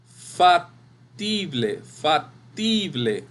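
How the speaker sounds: "Factible" slowly split, fac... TI-ble, stress on TI; very slow fac-TIII-ble for a class; syllable by syllable. Shown as a demonstration. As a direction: sound out "Factible" with a gap after fac and a long TI. Teacher sounds out the syllables.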